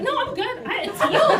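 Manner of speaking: high, loud laughing no I'm good; laughing brush-off. Retold or imitated. Morgan brushing off the helpful EMT, laughing high.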